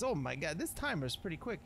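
normal talking voice

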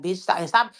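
usual speaking voice

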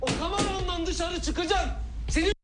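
furious rant. Acted. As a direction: threaten him at full pitch. Enraged rant at the crew.

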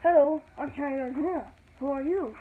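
put-on kid's loud, high puppet greeting. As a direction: make the toy talk, loud and perky. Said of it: "don't mind my ventriloquism".

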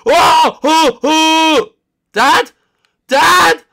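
high, loud panicked shrieks; terrified screaming. Acted. Kid screaming for his dad and friend during an attack. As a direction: scream for help in pure terror.

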